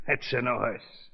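put-on thick foreign-accented gangster, taunting; menacing mobster in broken English; lightly accented mob boss toying with the judge.

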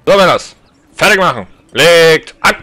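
loud sergeant commands; barked drill commands. Acted. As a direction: bark the orders at the troops. Drill sergeant commanding musket line.

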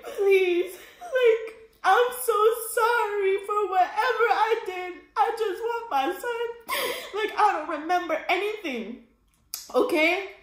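high, sobbing apology through laughs; crying plea. Retold or imitated. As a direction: beg through tears, pitch way up. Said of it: "a bucket of tears".

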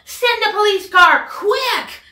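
high, loud urgent plea. Acted. Michael urging Clancy to send a car, loud. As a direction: demand help right now. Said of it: "yelled Michael".

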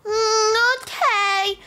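put-on high-pitched toddler voice; little child. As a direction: answer as a small, reluctant kid. Baby agrees.

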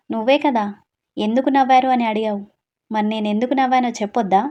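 ordinary delivery, nothing marked

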